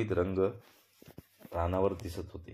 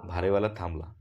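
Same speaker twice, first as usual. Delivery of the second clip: usual speaking voice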